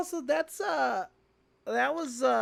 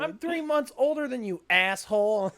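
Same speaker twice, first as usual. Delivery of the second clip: mocking quote; 6 semitones higher, 4 dB louder than usual. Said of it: "I love that line".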